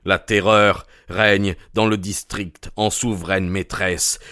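plain reading voice

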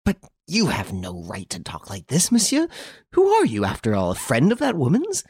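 high-pitched, outraged protest; choking fury. A narrator's reading. Furious woman challenges the man defending her enemy, choking indignation. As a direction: challenge him, voice shooting up in outrage. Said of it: "was choking with fury".

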